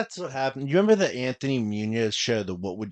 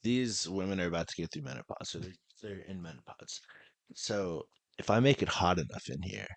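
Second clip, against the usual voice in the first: scheming mutter; 5 semitones lower, 9 dB quieter than usual. Retold or imitated. Mock Verizon manager scheming.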